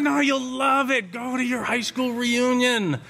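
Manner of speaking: lightly voiced old man pressing you to go; urging old man. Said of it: "He said".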